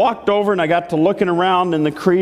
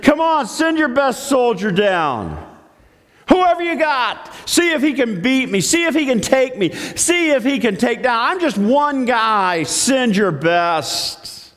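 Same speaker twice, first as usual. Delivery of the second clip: higher-pitched taunting call; mock challenge yell; 6 semitones higher than usual. Goliath daring the Israelites to send a soldier, mock yell. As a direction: call out a dare, lightly hammed-up. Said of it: "he yells up at the".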